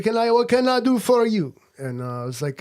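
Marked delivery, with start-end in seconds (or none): 0.0-1.5 high, loud eager waiter voice; French waiter. Retold or imitated. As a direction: play the waiter greeting a guest, pitched up. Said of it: "he said".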